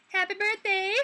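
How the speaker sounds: high, loud happy birthday call; bright shouted well-wishes; excited birthday cheer. Real, not acted. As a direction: cheer it out for the viewer. Greeting viewer's birthday in chat.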